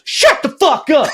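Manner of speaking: high mock yell from a stranger; sudden high-pitched shout, imitated; imitated angry yell. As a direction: yell it like a fed-up passerby. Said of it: "this voice from the ether goes".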